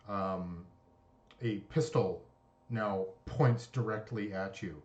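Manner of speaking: normal talking voice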